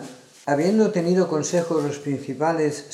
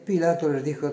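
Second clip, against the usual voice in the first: ordinary talk, nothing marked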